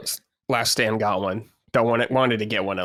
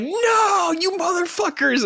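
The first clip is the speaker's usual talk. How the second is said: mock furious yell; slower than usual. Host retelling the fraud alert to the listeners, in high mock fury. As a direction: squeal it out in fake fury. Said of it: "I'm like".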